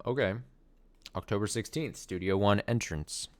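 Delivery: ordinary talk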